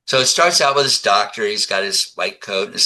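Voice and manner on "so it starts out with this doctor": normal talking voice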